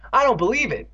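high-pitched disbelieving cry. A narrator's reading. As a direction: exclaim it high, in shock. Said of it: "Andy cried".

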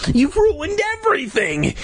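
high-pitched furious accusation; shriek. A narrator's reading. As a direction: shriek it without much extra volume. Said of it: "he shrieked".

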